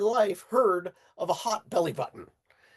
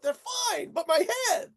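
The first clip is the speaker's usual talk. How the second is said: much higher, worried about his head; anxious whine; 13 semitones higher than usual. Retold or imitated. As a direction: whine it out, still hurting. Friend in pain, head.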